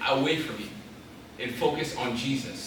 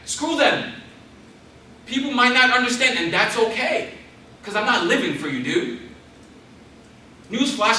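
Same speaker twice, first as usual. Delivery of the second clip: higher, louder defiant preaching; 8 semitones higher, 5 dB louder than usual. Real, not acted. Preacher defying social media critics, full force. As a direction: throw it back at the critics.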